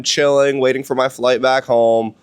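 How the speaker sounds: ordinary delivery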